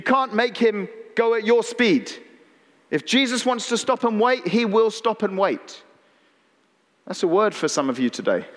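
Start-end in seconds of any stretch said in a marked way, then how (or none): none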